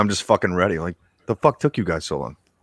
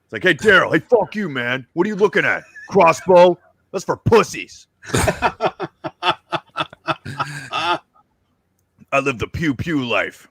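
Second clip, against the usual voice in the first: brash zombie destroyer talking trash to Daryl; hammed-up zombie hunter; 7 semitones higher than usual. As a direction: puff up like a gung-ho survivalist. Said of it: "I'll go from, like".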